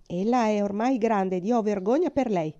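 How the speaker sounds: usual speaking voice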